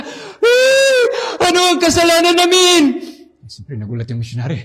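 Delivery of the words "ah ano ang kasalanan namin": high wailing cry of grief, much louder; a loud anguished sob-cry breaking into the question. Retold or imitated. Old man crying out to the missionary, wailing in anguish. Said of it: "Kaya nag-iyak siya ng malakas".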